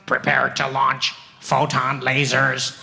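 robotic launch orders, slow; alien pilot. Pretend. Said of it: "two aliens in the cockpit, like".

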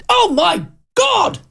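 high, drawn-out shout of relief; loud exclamation. Retold or imitated. Host leaving the cafe with his toastie, shouting loudly. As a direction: belt it out as you walk out the door. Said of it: "I did very loudly go".

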